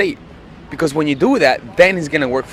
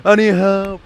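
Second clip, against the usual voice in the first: rising, hammed-up panic; 1=mock panic; 4 semitones higher than usual. Boyfriend panicking over the breakup, mock panic. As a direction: play the worried boyfriend freaking out. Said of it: "Make him say".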